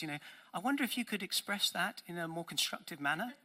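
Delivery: a lightly voiced, smug and patronizing child. Preacher imitating a child lecturing a sibling, lightly patronizing. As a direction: talk down sweetly to a sibling. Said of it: "outrageous, patronizing voice".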